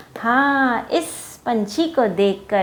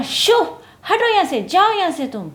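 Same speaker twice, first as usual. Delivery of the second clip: high, loud angry shoo; angry shout; 9 semitones higher, 4 dB louder than usual. Acted. Angry monkeys shout at the meddling bird, loud and high. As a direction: drive the bird away, yelling. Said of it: "तो उन्होंने कहा".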